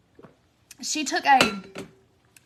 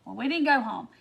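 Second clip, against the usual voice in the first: ordinary delivery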